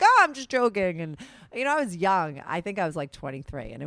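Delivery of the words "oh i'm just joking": higher and louder, breezy mock of the boyfriend. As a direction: toss it off like it was all a prank. Ex-boyfriend's fake-out joke quoted.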